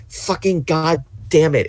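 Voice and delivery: high, drawn-out exasperated cursing; real frustration. Real, not acted. Player venting about annoying game sidekick, frustrated. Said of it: "Okay, I'm better".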